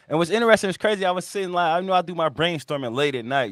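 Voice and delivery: ordinary talk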